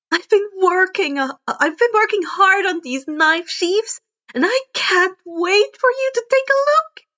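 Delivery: put-on high, loud, bubbly girl inventor, climbing at the end; bursting to show off her work; Hatsume voice. Inventor eager to show her friend the sheaths, high and bubbly.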